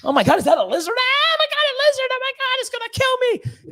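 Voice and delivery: high squealing mock panic over a lizard; mock freakout. Joe Rogan imitated squealing at a lizard. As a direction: shriek like someone terrified of a lizard. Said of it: "and he'll forget shit".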